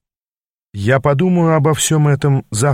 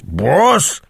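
higher-pitched repeat, rising in disbelief; outraged growl; 5 semitones higher than usual. A narrator's reading. Аас repeating the offending word, outraged growl. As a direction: growl it out, swooping up indignantly. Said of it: "прорычал Аас".

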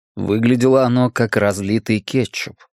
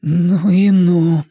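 slow, higher, quavering exclamation; trembling voice; 7 semitones higher, 4 dB louder, much slower than usual. A narrator's reading. Richie reacts to the thought of death, voice trembling. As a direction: draw it out with a tremble. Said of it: "с дрожью в голосе вымолвил он".

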